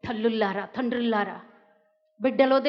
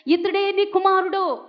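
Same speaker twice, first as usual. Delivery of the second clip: solemn declaration; 7 semitones higher, 5 dB louder than usual. Acted. Jesus entrusting John.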